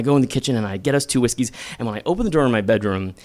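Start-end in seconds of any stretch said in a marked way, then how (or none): none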